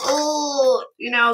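usual speaking voice

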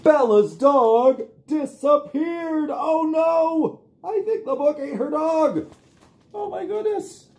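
high, loud storybook shock; dramatic alarm. Acted. Storyteller shocked that the book ate the dog. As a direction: gasp it out high for the kids.

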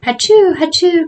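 loud, high fake sneezing fit; cried mock sneezes. A narrator's reading. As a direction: belt out two hammed-up achoos. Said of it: "cried Joe".

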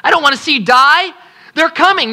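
ordinary delivery

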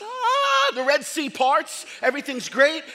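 ordinary talk

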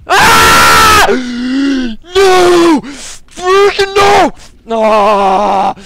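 very high, very loud screams; terrified screaming. Real, not acted. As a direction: scream out in real panic. Monster jump scare.

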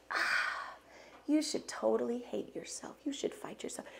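soft unvoiced whisper of self-blame; the deceiver whispering, goading; accusing whisper. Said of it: "there were more mirrors".

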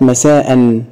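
مساء as masaa-an; fatha tanween. Shown as a demonstration. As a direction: end مساء in a glottal stop and "an". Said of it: "بالتنوين بالفتح مرة وبالكسر مرة وبالضم".

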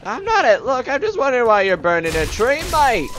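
loud, high Australian holler; Australian accent. Pretend. Gamer challenges tree burners, shouted Australian accent. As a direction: yell it in a hammed-up Aussie accent. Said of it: "accidentally turned on my Australian accent".

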